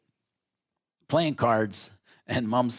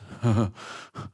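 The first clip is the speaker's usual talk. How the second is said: laugh. Retold or imitated. Boy laughs at the confession.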